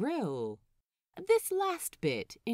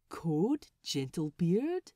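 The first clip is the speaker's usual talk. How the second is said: gentle question. Acted. The Swede politely asking Lucius, gentle question. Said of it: "he questions gently".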